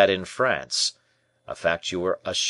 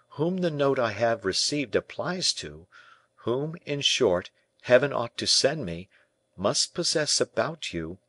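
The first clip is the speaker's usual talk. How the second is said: put-on higher-pitched, urgent young captive; young man's voice, pitched up, agitated; impassioned prisoner; 5 semitones higher than usual. A narrator's reading. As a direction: plead and accuse as the prisoner.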